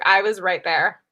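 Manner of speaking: usual speaking voice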